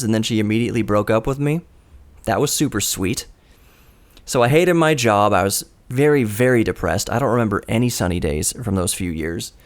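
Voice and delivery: ordinary talk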